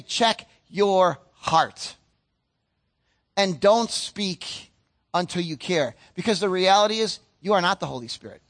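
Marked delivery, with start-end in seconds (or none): 0.0-6.0 slow, every word weighed; slow, weighty advice. Shown as a demonstration. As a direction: lay it down gently but firmly. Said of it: "I would say".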